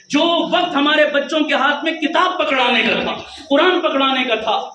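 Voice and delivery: impassioned pulpit preaching; fiery preaching. Acted. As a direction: preach it hard, building to a shout. Preaching at full force.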